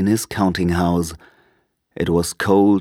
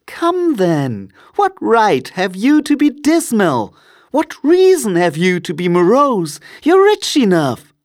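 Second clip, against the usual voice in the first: very high, lively teasing retort; playful nephew, climbing high and loud; cheerful nephew; 14 semitones higher, 4 dB louder than usual. A narrator's reading. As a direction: throw it back in a high-pitched, spirited voice. Nephew answers Scrooge back.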